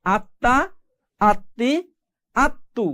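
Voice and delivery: "আত্মা, আত্মি, আত্তু" as at-ta, at-ti, at-tu; doubled consonant drill. Shown as a demonstration. Teacher recaps for learners, each word with a held double t. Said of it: "তাহলে উচ্চারণ কি হচ্ছে".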